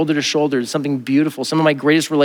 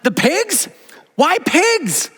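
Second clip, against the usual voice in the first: much higher, baffled "why pigs"; incredulous; 14 semitones higher, much slower than usual. Retold or imitated. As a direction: disbelief at the choice. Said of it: "And I'm like".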